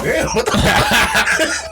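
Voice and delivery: slow, loud, high mock shock; startled reaction. Imagining being surprised by a tall woman at the bar, loud mock shock. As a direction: act startled, drawn out. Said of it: "you was like".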